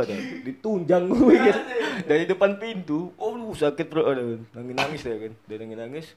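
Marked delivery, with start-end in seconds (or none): none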